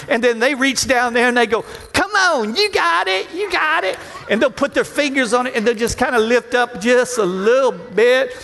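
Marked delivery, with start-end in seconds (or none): 1.9-4.0 high, eager coaxing spotter; pumped-up gym spotter urging; encouraging spotter. Retold or imitated. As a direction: cheer the lifter through the rep.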